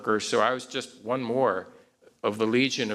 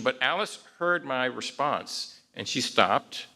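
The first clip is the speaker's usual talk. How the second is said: usual speaking voice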